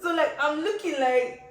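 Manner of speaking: high, loud laughing lead-in. Real, not acted. Telling the listener about the fight, laughing hard. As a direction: laugh through the words.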